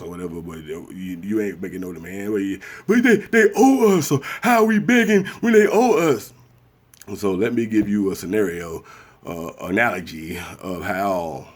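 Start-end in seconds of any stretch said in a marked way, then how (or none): 2.9-6.3 high, loud heated protest; heated outburst. Real, not acted. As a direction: drive the point home hard. Heated point about reparations.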